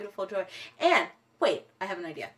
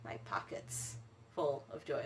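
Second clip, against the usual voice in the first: whisper; 11 semitones lower, 18 dB quieter than usual. Acted. Playful confiding aside.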